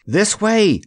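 high, drawn call; crying out. A narrator's reading. Jellia leads the escape, crying out. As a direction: call the group to follow. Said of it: "cried Jellia".

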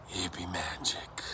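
slow, hushed whisper; awed whisper. A narrator's reading. Two pirates awed by John's magic, whispering. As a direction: whisper it slowly in wonder. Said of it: "They both whispered".

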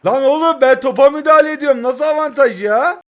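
shouting in outrage at the screen; angry protest. Real, not acted. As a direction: blow up at the referee's call. Protesting referee mid-match.

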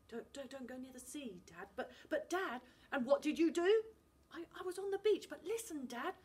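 a scared son trying to explain; nervous boy stumbling over words; frightened boy. Acted. Finn pleads with father.

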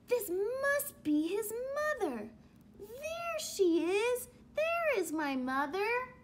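high, eager little voice; excited baby bird. Acted. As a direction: sure you've found her at last. Baby bird finds supposed mother.

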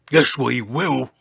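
loud grumbling contradiction; George. A narrator's reading. Dinosaur refusing to stop complaining, loud retort. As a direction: cut in loudly in character. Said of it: "said George".

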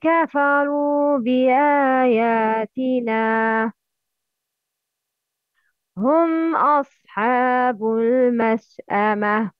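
solemn recited verse; Quran recitation. Acted. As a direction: recite it with measured tajweed. Quran recitation opening class.

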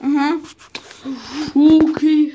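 ordinary talk, nothing marked

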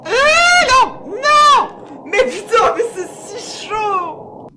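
high panicked shouts; panicked screaming. Real, not acted. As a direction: scream in terror as it chases. Chased by monster in game. (cris de panique)

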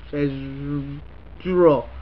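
schedule stretched out slowly, low; schedule spelled out very slowly; slow drawn-out schedule. Shown as a demonstration. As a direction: drag out every sound of schedule. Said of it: "Here's the word".

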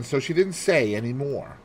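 ordinary delivery

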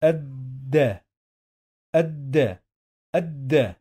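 الد said "ad-da", with no l; silent lam. Shown as a demonstration. Teacher showing learners unpronounced لام, silent lam in الد. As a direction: skip the l in الد, hold the doubled d. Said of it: "We don't pronounce this".

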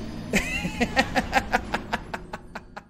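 long string of wheezy, voiceless chuckles; breathless laugh. Real, not acted. Streamer right after screaming at the game, breathless laugh. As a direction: laugh till the air runs out.

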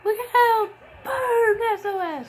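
high, loud yell of alarm; excited yell. Real, not acted. Commentator warning the wrestler in the ring, high excited yell.

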